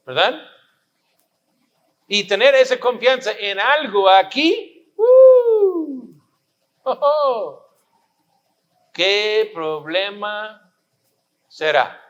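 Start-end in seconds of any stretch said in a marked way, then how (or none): 5.0-6.1 whooping exclamation. Acted. Preacher's exclamation.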